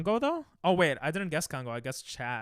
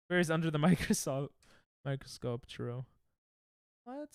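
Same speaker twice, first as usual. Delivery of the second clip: normal talking voice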